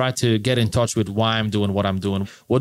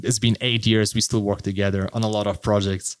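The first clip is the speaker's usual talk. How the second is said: ordinary delivery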